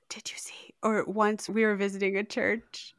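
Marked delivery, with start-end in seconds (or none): 0.1-0.7 whispered, no voice. Retold or imitated. Imitating mom afraid to name a mouse, whispered. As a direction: breathe it without sound, like mom afraid to say mouse. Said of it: "She can't say the word".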